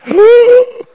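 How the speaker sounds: sharp, loud, high gasp of shock; sudden starstruck gasp, high and loud. Retold or imitated. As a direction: gasp like your idol just walked in. Star walks in, stunned.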